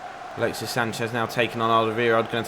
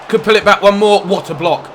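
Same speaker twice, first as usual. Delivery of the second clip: excited shout; 7 semitones higher, 7 dB louder than usual. Real, not acted. Chance in front of goal.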